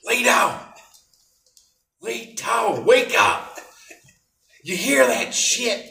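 very high, loud, strained yell; hammed-up roommate yelling someone awake; Tony impression. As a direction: shout it in an exaggerated high-pitched character voice. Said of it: "do my best Tony imitation impersonation".

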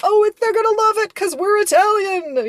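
high, loud, gushing mock brag; over-the-top confident exclamation; boastful Italian-American. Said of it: "where they're being like".

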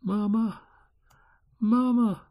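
put-on small high-pitched child's whimper; a little child weeping for mama, high and soft-edged; high, plaintive child's cry; quiet weeping. A narrator's reading. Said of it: "wept the little child very quietly".

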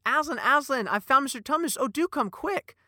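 put-on high, loud, eager girl's call; excited Lucy. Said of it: "Lucy goes".

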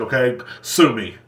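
ordinary delivery

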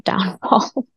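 real small laugh breaking in. Real, not acted. Survivor recalling part of her downfall, laughing. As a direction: let a short laugh slip into the word. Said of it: "Even though I'm laughing".

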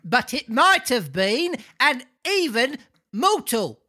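put-on high-pitched comic old man; crying out as old man. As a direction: exclaim it in the high host-like voice. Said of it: "cried the old man".

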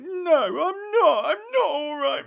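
high, drawn-out crying imitation; mock tearful wail. Upset girl at a party answers a stranger, mock crying.

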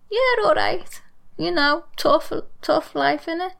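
Scouse accent, chirpy. Shown as a demonstration. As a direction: brush off grief in broad Scouse. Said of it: "you almost never hear me speak Scouse".

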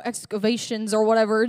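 ordinary talk, nothing marked

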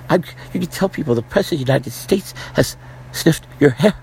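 mocking impression of a creepy old candidate; Joe Biden impression. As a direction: play a doddering politician leaning in too close. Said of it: "He's talking like this all the time".

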